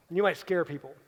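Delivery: normal talking voice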